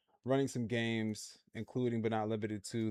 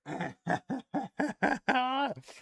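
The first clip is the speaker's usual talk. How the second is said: long, high-pitched cackling laugh; real helpless giggling fit; high laughter; 14 semitones higher, 4 dB louder than usual. Real, not acted. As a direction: crack up at what you just watched. Laughs at the clip.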